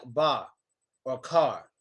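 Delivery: "bar" and "car" with a little final R; low-pitched, lightly sounded R on "bar", "car"; New York R. Shown as a demonstration. Teacher showing New York version, slight R. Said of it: "we give it a little R sound".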